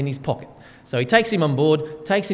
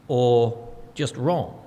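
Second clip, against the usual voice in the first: each word drawn out, very slow; slow emphatic close; 4 semitones lower, much slower than usual. Acted. Preacher ending the list, drawing out each word. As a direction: land it slowly and heavily.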